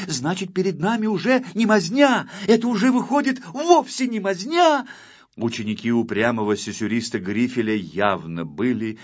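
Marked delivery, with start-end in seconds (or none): 0.1-4.9 high-pitched, excited pupil's realisation; astonished. A narrator's reading. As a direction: reason it out in growing amazement. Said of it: "удивилась ученица Акварелька". (изумлённо, высоко, с нарастанием)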